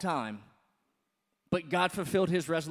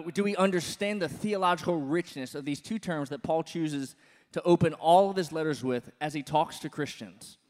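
usual speaking voice

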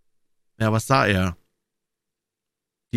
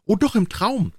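put-on much higher, childlike storybook voice; high-pitched children's reply; orphan children; 10 semitones higher than usual. A narrator's reading. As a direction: answer high, like children contradicting a teacher. Children answer the teacher.

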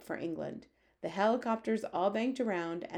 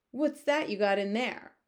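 demanding; 4 semitones higher, 5 dB louder than usual. Acted. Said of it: "demanded to know".

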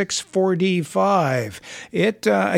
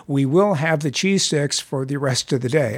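normal talking voice

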